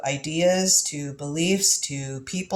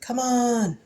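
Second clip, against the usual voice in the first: coaxing, teasing invitation pitched up; flirty come-on; 7 semitones higher than usual. Retold or imitated. Tarot reader flirting with the death card, coaxing. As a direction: lure death in playfully. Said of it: "trying to make out with it".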